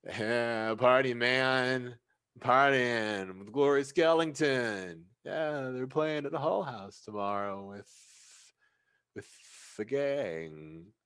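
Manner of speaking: put-on slow, slurred drunk party guy; drunk Jasper. Drunk Jasper boasting to the bouncer, slow and slurred. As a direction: drawl it out like a happy drunk. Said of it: "And Jasper then goes like".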